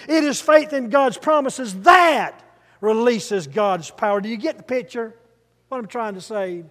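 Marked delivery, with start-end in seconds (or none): 0.1-4.2 "that" punched loud and held; stress on that. Shown as a demonstration. Preacher drills congregation word by word, stress on "that". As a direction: build to a big "that", then finish. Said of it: "with an emphasis on each word".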